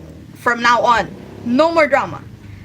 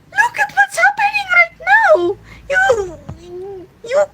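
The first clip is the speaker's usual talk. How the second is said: much higher and louder, yelled out; outraged shout; 4 dB louder than usual. Real, not acted. Blaming the government for the country's state, in an outraged shout. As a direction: throw it out as a full outraged yell.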